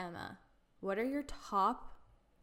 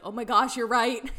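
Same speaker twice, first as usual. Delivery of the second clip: louder retold gasp of realization; mock surprise; 10 dB louder than usual. Retelling her brother's realization, loud mock surprise. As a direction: act out the brother catching on.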